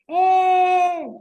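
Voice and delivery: loud, high drawn-out shout. Shown as a demonstration. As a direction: call out "eh!" at full volume. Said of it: "Estoy levantando la voz".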